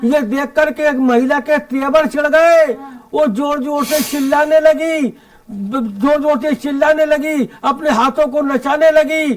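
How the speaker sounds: lilting, declaimed humorous verse; comic poem recitation. Acted. Poet telling the Holi mishap to the audience, comic verse recitation. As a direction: recite in measured lines for a live crowd.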